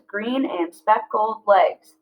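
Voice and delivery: slow, each syllable spaced out; slow syllable-counting read. Shown as a demonstration. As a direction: read as if clapping beats. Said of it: "that's five syllables".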